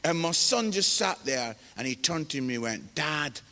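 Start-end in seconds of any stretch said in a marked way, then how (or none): 2.9-3.4 low, soft deadpan reply; solemn son. Retold or imitated. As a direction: imitate a son calmly putting dad down. Son's deadpan answer to father.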